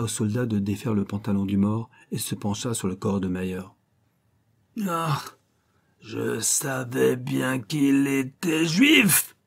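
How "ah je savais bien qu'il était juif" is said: slow, higher triumphant yell; shouting. Acted. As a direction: howl it out in triumph. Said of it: "hurla-t-il".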